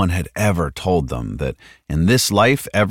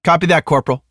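higher, matter-of-fact acknowledgement; 11 semitones higher, 4 dB louder, faster than usual. A narrator's reading. Martinez answering the corporal's order, matter-of-fact. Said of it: "Martinez sounded matter-of-fact".

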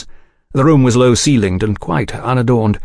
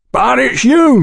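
much higher, louder startled recognition; surprised proprietor; 4 dB louder, slower than usual. A narrator's reading. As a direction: caught off guard by the copper. Sayers voiced by narrator.

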